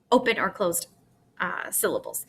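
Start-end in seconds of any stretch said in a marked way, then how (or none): none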